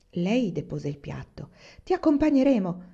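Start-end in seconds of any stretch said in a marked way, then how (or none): 1.8-2.8 bright, higher and louder offer; eagerly offering to come along; cheerful exclamation. Acted. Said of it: "esclamò allegra". (esclamazione allegra)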